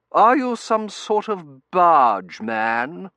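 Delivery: haughty lady voice. Acted. Mrs Meering character voice.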